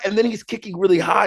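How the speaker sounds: normal talking voice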